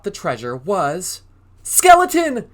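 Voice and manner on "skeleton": sudden high, loud shout of the name. A narrator's reading. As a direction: yell it out in alarm. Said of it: "shouted Goblin".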